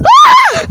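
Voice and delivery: sudden shriek, caught; high scream. Real, not acted. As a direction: shriek when grabbed. Caught in the game. (cri aigu)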